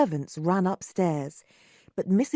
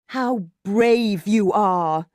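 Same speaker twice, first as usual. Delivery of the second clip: slow, loud and scornful; 6 dB louder, much slower than usual. A narrator's reading. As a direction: sneer it at the crowd. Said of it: "he said scornfully to the crowds".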